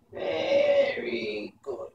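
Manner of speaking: high crone voice, slow menacing very good; angry old witch. A narrator's reading. Mombi threatens the boy who tried to scare her.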